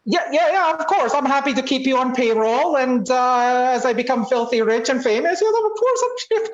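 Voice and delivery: high, smug and patronizing; a grand boss talking down. Acted. Condescending rich partner.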